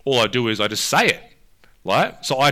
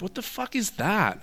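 startled disbelief; 10 semitones higher, 3 dB quieter than usual. Retold or imitated. Acts out own startled realisation.

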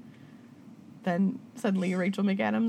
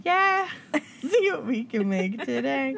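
put-on slow, sing-song kids' TV host line; Barney voice; 5 semitones higher, slower than usual. Repeating the Barney line in a slow sing-song kids' host voice. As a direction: croon it like a children's show. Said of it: "how I learned how to do arts and crafts".

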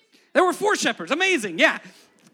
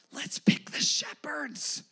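playful whisper; 5 semitones higher, much slower than usual. Pretend. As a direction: lean in and let God whisper the plan. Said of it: "God goes".

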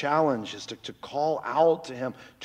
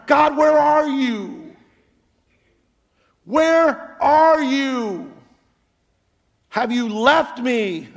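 loud, high, drawn-out cry of despair; crying out to God; 11 semitones higher, 8 dB louder, much slower than usual. Retold or imitated. Preacher reenacting his desperate cry to God. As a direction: wail it out like you're all alone. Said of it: "But I cried".

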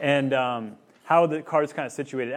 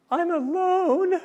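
put-on much higher, slow, whiny little girl; pouty toddler voice; 5 dB louder, much slower than usual. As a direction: stretch it out like a lonely little kid. Dad voicing crying toddler daughter.

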